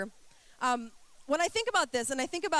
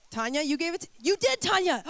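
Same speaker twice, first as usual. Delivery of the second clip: teasing accusation; 5 semitones higher, 3 dB louder than usual. Real, not acted. Teasing blame on congregant.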